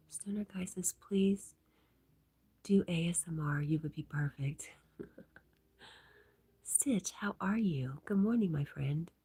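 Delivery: hushed ASMR-like murmur; whisper. Real, not acted. Streamer whispers chat messages to viewers.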